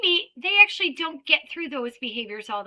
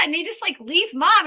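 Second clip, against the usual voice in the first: usual speaking voice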